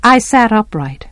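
ordinary delivery, nothing marked

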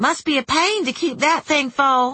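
high folksy cashier voice; high-pitched, chatty clerk; chatty store clerk. A narrator's reading. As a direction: make small talk high and folksy. Clerk chats to customer.

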